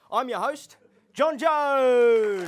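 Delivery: hammed-up host fanfare; self-announcing shout. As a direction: trumpet your own name. Host hyping his own name.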